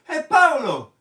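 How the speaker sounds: calling out. Pretend. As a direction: shout across the street to a friend. Calling out a friend.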